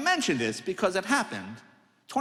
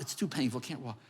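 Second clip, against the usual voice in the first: ordinary delivery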